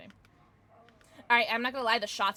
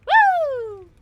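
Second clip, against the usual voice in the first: excited cheer; 16 dB louder than usual. Real, not acted. Cheering during play.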